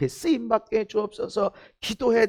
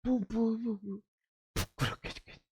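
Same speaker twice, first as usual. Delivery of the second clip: embarrassed stammer; 12 dB quieter than usual. Retold or imitated. Preacher acting out embarrassed stammer.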